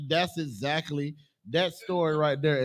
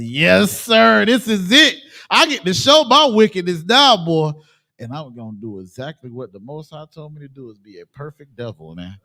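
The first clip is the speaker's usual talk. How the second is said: gloating villain; 7 dB louder than usual. Retold or imitated. Speaker voicing the oppressor plotting wickedness, gloating villain. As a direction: relish it like a villain rubbing his hands. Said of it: "he gritting his teeth and rubbing his hands".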